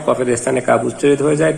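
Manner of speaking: usual speaking voice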